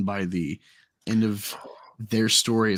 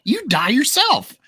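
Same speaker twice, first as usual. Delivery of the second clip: mocking squeaky voice; 3 dB louder, much slower than usual. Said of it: "I'm like".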